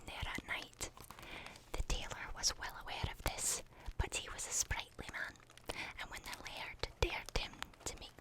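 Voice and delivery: ASMR whisper reading a spooky story. Acted. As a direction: whisper the tale like a bedtime ghost story.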